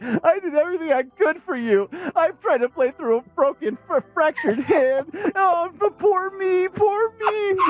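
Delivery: very high-pitched, hammed-up crybaby wail; exaggerated tearful whine, squealed high; mock whining and crying. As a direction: mockingly whine and sob, dragging out the self-pity. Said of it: "he just started whining and crying afterwards".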